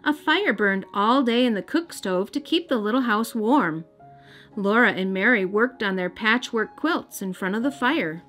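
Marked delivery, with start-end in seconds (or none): none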